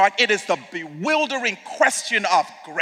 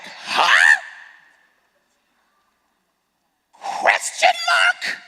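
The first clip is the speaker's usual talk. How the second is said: high, drawn-out baffled Question mark?; be stunned and puzzled; bewildered huh; much slower than usual. Retold or imitated. Preacher voicing his own bafflement at Jesus calling traitors friends, a high stretched huh. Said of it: "I'm like".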